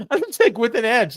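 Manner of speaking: high laughing disbelief. Real, not acted.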